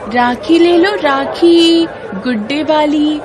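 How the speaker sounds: high, loud street-seller call. A narrator's reading. Girl hawking rakhis in the market, loud high calls. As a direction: cry out the rakhi wares to passersby. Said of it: "जोर से चिल्लाती है".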